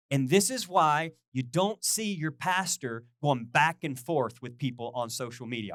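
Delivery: ordinary talk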